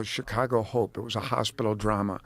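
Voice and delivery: ordinary talk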